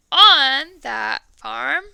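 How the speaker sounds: on that farm slow and loud; slow stressed phrase. Shown as a demonstration. Teacher modelling the verse, slow and loud. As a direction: draw out on that farm loudly. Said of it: "that's where you're gonna start".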